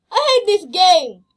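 a hammed-up angry yell; mock outburst. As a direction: pretend to be fed up with the game. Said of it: "Just kidding".

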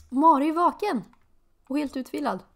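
high, loud bright announcement; excited. Real, not acted. Player delighted her Sim is wide awake.

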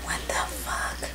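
stunned, slow whisper; whispered disbelief. Real, not acted. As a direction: breathe it out in shock. Muttered in disbelief.

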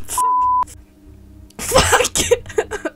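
loud, high-pitched scared swearing; frightened scream. Real, not acted. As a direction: shriek the curse in fright. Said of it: "did you hear me scream".

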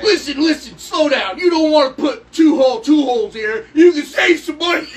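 loud, high, pushy sales pitch; super-duper car salesman. Acted. Salesman helping a customer through the order, pushy shout. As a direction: build to a hammed-up salesman shout. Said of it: "like a super duper car salesman".

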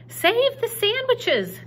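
high, loud, slow yell. Acted. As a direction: shout it over the rain to save the food. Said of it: "Gloria Dump yelled to me".

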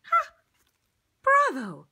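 loud high ha, then bravo; triumphant bravo. Acted. Applauding the child at the end, loud and delighted. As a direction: celebrate the finish. Finale cheer.

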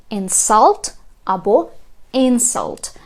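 "insult" stressed on SULT, then "insult" stressed on IN; two stresses contrasted. Shown as a demonstration. Teacher showing two accents of one word, stress contrast. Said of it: "Два різних наголоси".

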